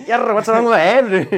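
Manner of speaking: loud high complaint; exasperated at own hand; frustrated exclaim. Retold or imitated.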